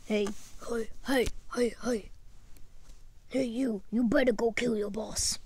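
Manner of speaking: sinister hissing whisper; creepy whisper. Pretend. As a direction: whisper like an evil voice. Sinister voice urging murder.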